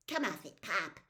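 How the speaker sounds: cold, dismissive brush-off. Acted. Possessed boy to his father, cold and dismissive. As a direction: sneer it at him. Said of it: "came the cold voice from Charles Wallace".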